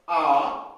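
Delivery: आ held long and said loud; vowel demo. Shown as a demonstration. Teacher shows students the long German a, loud and held. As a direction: sound out आ as a long open aa. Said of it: "so we can spell like".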